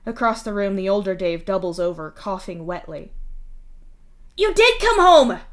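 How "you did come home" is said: much higher, loud forced cheer; insisting. Acted. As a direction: shout it to convince him. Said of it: "Mituna insists".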